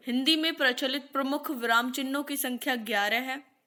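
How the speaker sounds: ordinary delivery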